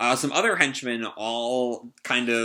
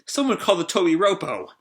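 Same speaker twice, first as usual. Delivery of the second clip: high, urgent acted call; raised-pitch young hero's shout, half-strength; calling back; 8 semitones higher, faster than usual. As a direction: call ahead to a companion in a rush. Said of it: "Luffy calls back to him".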